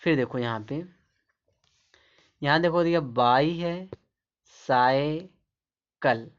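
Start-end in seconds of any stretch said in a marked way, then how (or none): 3.0-6.3 बाई, साइ, किल said one by one with long gaps; split into syllables. Shown as a demonstration. Teacher breaks the word up for learners, syllable by syllable. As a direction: break बाई cycle into separate, drawn-out pieces. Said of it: "बाई अलग है".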